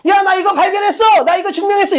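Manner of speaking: high, excited mock proclamation; excited announcement. Writer announcing a discovery to readers, excited and high. Acting out an author announcing a finding.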